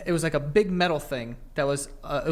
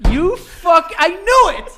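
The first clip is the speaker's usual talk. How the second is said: much higher, louder laughing yell; triumphant shout; 14 semitones higher, 9 dB louder, slower than usual. Real, not acted. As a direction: call him out loud. Friend's triumphant accusation.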